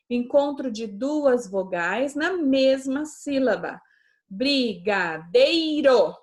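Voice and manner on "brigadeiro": slow, syllable by syllable; teacher spelling out a word for pupils. Shown as a demonstration. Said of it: "a gente separa".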